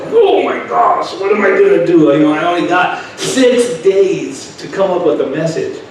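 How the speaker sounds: high, loud panicked outburst; inner scream acted out. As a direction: act out a silent scream, voiced high and loud. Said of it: "I was screaming inside".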